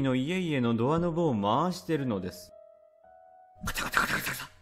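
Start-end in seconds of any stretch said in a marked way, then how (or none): 3.6-4.5 whispered rattling doorknob noise. Retold or imitated. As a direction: rattle it out under your breath.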